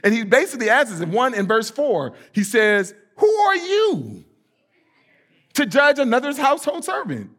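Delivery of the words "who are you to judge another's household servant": preaching scripture. Acted. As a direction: drive the question home from the pulpit.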